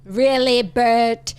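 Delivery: a light mock British "Really, Bert?"; lightly done British accent; Moira Rose accent. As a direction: echo the child's posh British line. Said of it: "She sounded like Moira Rose".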